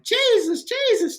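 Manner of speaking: very high, wailing cry of Jesus; mom crying out to Jesus. Retold or imitated. Son retelling his crying mom, high wailing mock cry. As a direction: imitate mom's high tearful calling. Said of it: "My mom was like".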